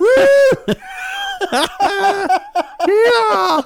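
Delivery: high, loud whooping cheer through laughter; real cheering laugh. Real, not acted. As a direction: whoop it up in delight. Cheering the roadkill selfie joke.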